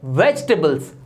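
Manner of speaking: वेजिटेबल्स squeezed to "VEJ-tuh-buls"; correct pronunciation. Shown as a demonstration. Teacher models for learners, वेजिटेबल्स said "vej-tuh-buls". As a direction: drop the i in वेजिटेबल्स. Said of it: "ये होता है".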